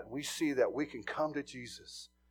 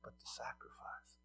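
soft whisper on the key point; whispered emphasis; 2 semitones lower, 18 dB quieter than usual. Real, not acted. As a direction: breathe it out softly. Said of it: "preacher key point".

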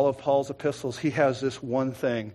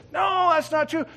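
high-pitched indignant denial; acted protest; 13 semitones higher, 4 dB louder than usual. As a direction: protest your innocence, voice raised. Acted suspect protesting the accusation.

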